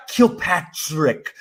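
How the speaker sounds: KILPATRICK very slowly, in his own accent; careful attempt without accent. Shown as a demonstration. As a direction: stretch out KILPATRICK, trying to keep it normal. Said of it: "Like, I try".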